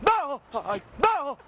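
high-pitched startled cry; feigned fright. Acted. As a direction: cry out as if spooked. Feigned fright at someone's face.

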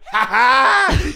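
sudden mock cackle; imitated giggling laugh. Imitating the high, drunk cult leader among gigglers, mock laugh. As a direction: burst into a put-on cackle. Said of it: "they're all just giggling".

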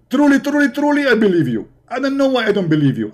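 high, mocking truly, truly, then I believe you; sarcastic. Host sarcastically telling the caller he believes him. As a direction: drip with fake belief.

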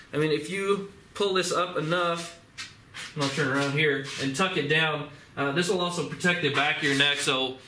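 muffled behind cloth gaiter; speaking through fabric covering; through a face mask. Real, not acted. Seen 1: Skull neck gaiter covers mouth and nose throughout labelled speech.

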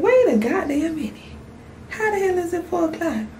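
high-pitched, incredulous; can't believe the time; shocked disbelief. Retold or imitated. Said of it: "I said".

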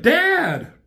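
put-on high shout of a scared kid; high, loud cry for his father; child crying out. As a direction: call out for dad in alarm. Kid Daniel calling out.

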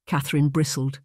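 plain reading voice

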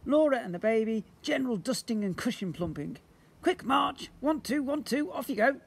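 hammed-up army commands; drill sergeant dad. A narrator's reading. Father drills the children into chores, mock sergeant.

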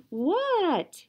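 high What shouted; yelling back. Acted. Froggy yelling back to his mother, high shout. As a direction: call back from outside. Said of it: "yelled Froggy".

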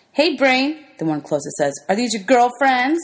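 mocking taunt. Bully jeers at the boy walking with girls, high and mocking. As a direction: jeer at him like a schoolyard bully.

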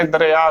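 ਦਰਿਆ said "da-re-aa", without final o; wrong shortened form. Shown as a demonstration. Teacher showing the form to avoid, mispronunciation. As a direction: drop the ending: ਦਰਿਆ as "dareaa". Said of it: "ਗਲਤ ਉਚਾਰਨ ਹੋਵੇਗਾ".

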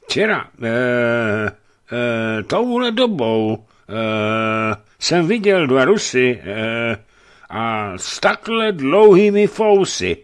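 put-on a nervous young servant groping for words; hesitant servant. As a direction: stammer it out like a timid servant. Servant Jakub voiced hesitantly.

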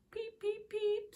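tiny chick peeping; baby bird peeps. A narrator's reading. Storyteller peeping as the third baby bird. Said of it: "said baby bird number three".

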